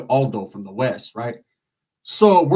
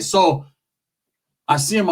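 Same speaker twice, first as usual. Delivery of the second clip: ordinary talk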